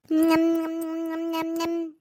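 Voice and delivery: playful munching sounds, nom nom; munching nom noises. Acted. Mother-in-law alone with her pizza, happy munching noises. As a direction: chomp away happily, nom nom.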